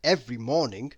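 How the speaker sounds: ordinary delivery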